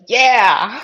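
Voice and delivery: loud, high retold whoop; excited cheer. Caddy backing his golfer's vow to win, excited cheer.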